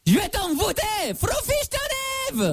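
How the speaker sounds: magic curse. Acted. Fake curse cast at caller.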